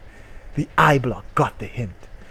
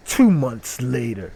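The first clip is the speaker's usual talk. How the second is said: ordinary talk, nothing marked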